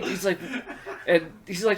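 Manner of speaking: ordinary talk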